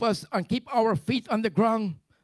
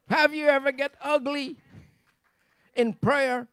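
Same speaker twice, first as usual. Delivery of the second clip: preacher working the church up to a cry; building preaching; 2 semitones higher, 7 dB louder than usual. Acted. Preacher to the church about ugly prayer, building to a shout.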